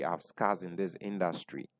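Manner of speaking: quiet, heavy restatement of "scars". Real, not acted. Repeated line for emphasis.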